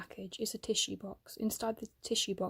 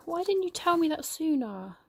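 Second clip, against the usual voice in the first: higher, louder hurt question; hurt outburst; 8 semitones higher, 5 dB louder than usual. Acted. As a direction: plead with wounded disbelief. Hurt friends argue.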